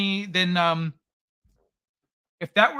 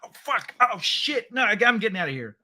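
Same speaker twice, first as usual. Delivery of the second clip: high, fast mock panic as he flees the fireworks; burned by fireworks, he squeals and bolts; panicked yelp; 5 semitones higher than usual. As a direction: yelp and scramble away, hammed up. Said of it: "He's like".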